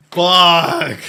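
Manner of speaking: overwhelmed outburst; real loud curse. Real, not acted. Streamer moved by the anime scene, loud curse.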